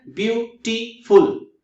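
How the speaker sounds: Beautiful slowly in three beats, ब्यू-टी-फुल; syllable by syllable. Shown as a demonstration. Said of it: "three syllables है वहां पे".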